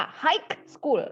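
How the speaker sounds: "high" ending in a hard k; wrong pronunciation. Shown as a demonstration. Teacher exaggerating the added K, wrong pronunciation. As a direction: say "high school" as "haik... skul". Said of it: "pakai K".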